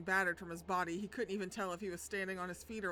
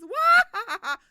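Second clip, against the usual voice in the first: much higher, loud Waluigi cackle; Waluigi laugh; 19 dB louder than usual. Pretend. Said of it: "He's Waluigi".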